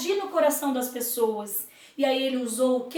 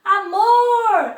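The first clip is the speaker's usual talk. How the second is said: higher, louder call across the water; 7 semitones higher, 7 dB louder than usual. Acted. Time calling Love from the boat, loud call. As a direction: wave and call his name. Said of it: "o tempo acenou assim".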